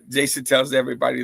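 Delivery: ordinary talk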